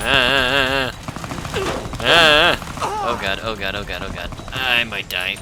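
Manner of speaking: loud panicked yelps under fire; terrified gibbering. Real, not acted. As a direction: panic as if about to die.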